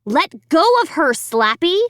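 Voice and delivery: demanding. A narrator's reading. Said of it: "I demanded".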